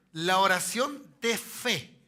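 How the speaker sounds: "La oración de fe" slow and loud, word by word; "La oración de fe" drawn out forcefully for the congregation; emphatic verse reading. Real, not acted. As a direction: drive home each word of "La oración de fe".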